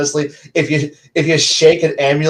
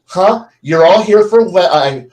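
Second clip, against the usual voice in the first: ordinary delivery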